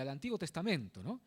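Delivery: normal talking voice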